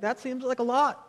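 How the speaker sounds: high-pitched, mock-overwhelmed aside; overwhelmed reaction. Preacher voices his own uneasy reaction.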